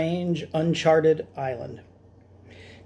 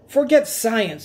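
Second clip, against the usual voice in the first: higher squeaky brush-off; squeaky dismissal; 6 semitones higher, 3 dB louder, slower than usual. Acted. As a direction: wave science away, squeaking. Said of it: "squeaked Doctor Varmint".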